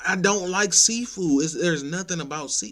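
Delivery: venting hard about being pushed to eat; exasperated rant. Real, not acted. Ranting to viewers about pushy date, loud exasperated.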